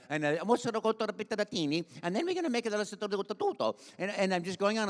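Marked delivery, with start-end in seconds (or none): 2.0-2.7 high Italian cartoon babble; Mario. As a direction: keep rambling in a high Italian character voice. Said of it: "I kept talking, talking, talking".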